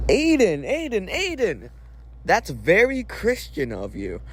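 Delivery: high, falling sing-song on the name; mock chiding. Vlogger scolding the friend for swearing, mock chiding. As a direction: tut at them by repeating the name.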